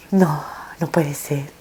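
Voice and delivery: slow breathless whisper of disbelief; hushed, slow whisper of denial; stunned whisper. Retold or imitated. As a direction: whisper "No, no puede ser" slowly, as if to herself. Disbelief at son's death.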